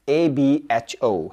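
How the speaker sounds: letters spelled out slowly one by one; slow letter dictation; slow spelling. Shown as a demonstration. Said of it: "क्या कहा मैंने?".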